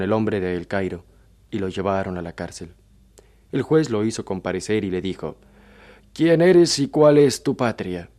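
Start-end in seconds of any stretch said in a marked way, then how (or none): 6.1-8.1 slow, loud, higher-pitched questioning; raised voice of a magistrate demanding answers; stern interrogating judge. Acted. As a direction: question the prisoner like a judge, loudly and slowly.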